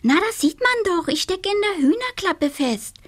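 high scatterbrained witch voice; little witch voice. Acted. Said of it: "entgegnete Donatella die Schusslige".

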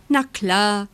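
ordinary delivery

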